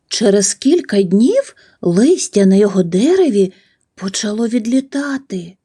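usual speaking voice

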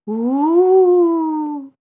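put-on high excited kid's woo; loud playful whoop; Halloween woo. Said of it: "kids running around all over the place going".

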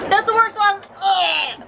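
high disgusted complaint. Real, not acted. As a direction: whine at the awful taste. After tasting rotten egg.

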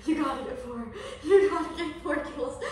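laughing while reading it out. Real, not acted. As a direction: giggle through the words. Reading game text laughing.

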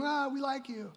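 high, then dropping to soft, a hint of dismissive gods; lightly voiced condescending gods; aloof gods. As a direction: brush them off from on high. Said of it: "gods ... looking down going".

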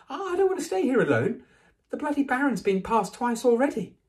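high, loud, stammering panic; scared boy, pitched high; frightened pleading. A narrator's reading. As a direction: plead in rising alarm not to be left. Neville begs not to be left.

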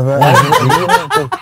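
words breaking into laughter; laughing. Real, not acted. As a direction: burst out laughing in disbelief. Laughing at the joke.

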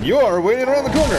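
fast, high, swooping exclamation; excited discovery. Real, not acted. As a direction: call out the ambusher in a rushed high pitch. Finds an enemy lying in wait.

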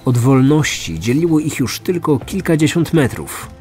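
ordinary talk

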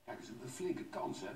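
usual speaking voice

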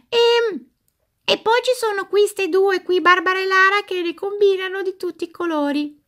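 high, chirpy toy doll voice; squeaky doll voice. Acted. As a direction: play a cheeky little doll. Doll voice continues.

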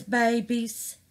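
ordinary talk, nothing marked

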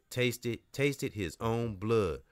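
ordinary delivery

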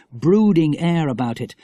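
ordinary delivery, nothing marked

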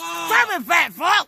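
loud shouted insult. Real, not acted. Grandma shouting an insult at grandson. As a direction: yell it right at him.

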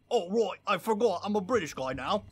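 hammed-up British accent; mock British accent. Player mocking a game boss who remembers he's British, hammed-up British accent. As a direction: imitate someone putting a British accent back on. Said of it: "he brought it back".

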